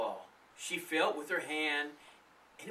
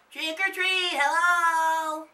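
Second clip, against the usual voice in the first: calling out; 11 dB louder, slower than usual. A narrator's reading. Calling into the house.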